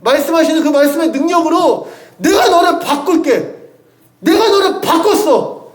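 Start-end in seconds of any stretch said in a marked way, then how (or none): 2.2-5.7 higher, slow, joyful promise; tender divine declaration. Retold or imitated. Preacher voicing God to the congregation, slow joyful reassurance. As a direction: say it as God assuring a weary child. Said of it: "이렇게 하나님이 선언하시는".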